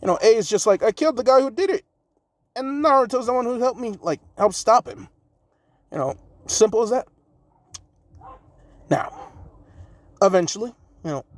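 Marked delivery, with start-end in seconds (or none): 0.8-5.1 higher, fast blunt declaration; blunt Raikage. Acted. Raikage telling the Hyuga head who killed the kidnapper. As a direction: state it flat out, case closed.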